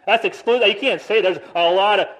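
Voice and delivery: imitating a loud, indignant objection; mock outraged world. Preacher mocks the world calling the gospel bigoted, loudly. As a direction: play the offended critic. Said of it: "they say".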